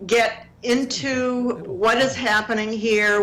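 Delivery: ordinary delivery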